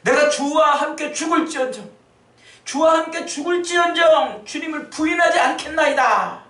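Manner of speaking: arguing Peter. Acted. Peter insisting to Jesus that he will die with him. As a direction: argue back hard, stung. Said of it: "따지듯이".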